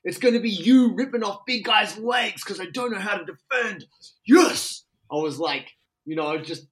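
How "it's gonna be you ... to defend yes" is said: high, loud pumped-up urging; excited cheering, pitched way up; enthusiastic hype. Retold or imitated. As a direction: hype your buddy up like a coach. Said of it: "I get very enthusiastic".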